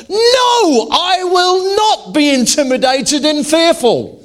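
high, loud, slow defiant declaration; defiant shout. Retold or imitated. The shirt's slogan, read out as a high, loud, slow defiant shout. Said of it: "the shirt that says".

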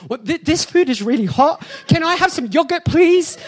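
high, pleading ask; pleading squeak. Retold or imitated. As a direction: beg politely in a squeaky voice. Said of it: "Or maybe I should say".

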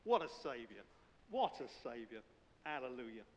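ordinary talk, nothing marked

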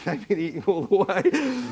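laughing mock disbelief; amused disbelief. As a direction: retell the thought while cracking up. Amused aside in his funny story.

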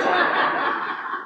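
breathless high-pitched laugh; real laughter. Real, not acted. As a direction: burst into loud laughter. Big laugh.

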